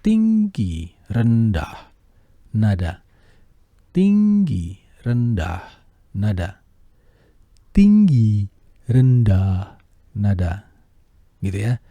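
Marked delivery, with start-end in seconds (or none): 0.0-3.0 Tinggi very high, rendah back down low; high then low. Shown as a demonstration. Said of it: "contohkan ya". 3.9-6.6 Tinggi very high, rendah back down low; high then low. Shown as a demonstration. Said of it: "contohkan ya". 7.7-10.7 loud demo take; louder take. Shown as a demonstration. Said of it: "contohkan ya".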